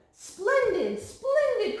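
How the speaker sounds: high, loud and thrilled; a delighted cry of splendid; cried out with delight. A narrator's reading. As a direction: burst out with delight at the falcon. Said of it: "cried Mister Secretary".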